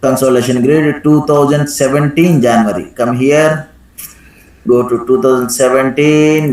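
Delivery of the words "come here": usual speaking voice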